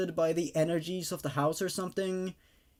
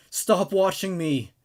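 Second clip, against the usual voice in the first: screamed; 5 dB louder than usual. Acted. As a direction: drag out each word in a frantic scream. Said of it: "screamed Lizzie".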